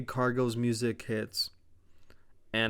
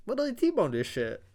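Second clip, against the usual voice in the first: squeaky, much higher repeat; high-pitched disbelief; 10 semitones higher than usual. Real, not acted. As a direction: echo it in a silly high voice. Repeats crash in silly high voice.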